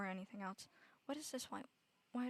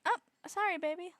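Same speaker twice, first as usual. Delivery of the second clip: high, sing-song pet talk; 8 semitones higher, 4 dB louder, faster than usual. Real, not acted. Apologizing and soothing pet.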